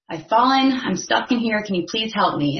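storyteller's pleading shout, fading toward talk; mock cry for help. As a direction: call up out of a hole, acted lightly. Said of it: "he screams out for help".

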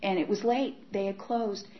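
normal talking voice

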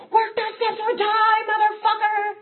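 hammed-up finger-wagging shout at the room; bellowed warning. Big scary guy roaring at everyone to work the steps, bellowing.

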